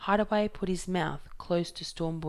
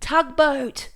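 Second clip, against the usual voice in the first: much higher, much louder shout; 9 semitones higher, 11 dB louder than usual. Acted. Fingerbone calls out the wreck to Hideaway, loud high shout. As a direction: bellow it across the sandhill. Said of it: "he shouted".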